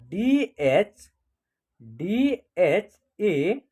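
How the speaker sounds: DH, डीएचएएन spelled out letter by letter, very slow; slow letter spelling. Shown as a demonstration. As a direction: draw out each English letter of डीएचएएन for the class. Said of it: "DHA से क्या होगा".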